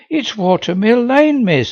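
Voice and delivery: cheerful, eager schoolgirl answer; bright. Acted. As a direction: chirp it out brightly. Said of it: "said Molly brightly".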